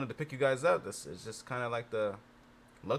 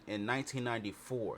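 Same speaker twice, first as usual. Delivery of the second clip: ordinary talk, nothing marked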